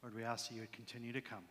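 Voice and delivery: low, hushed prayer; quiet prayer. Real, not acted. Pastor asking the Lord to keep coming, quiet prayer. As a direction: pray softly under your breath.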